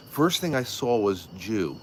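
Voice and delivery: ordinary talk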